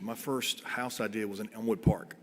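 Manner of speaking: ordinary delivery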